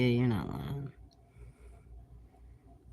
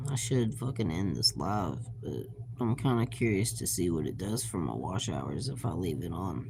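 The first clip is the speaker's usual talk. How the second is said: ordinary delivery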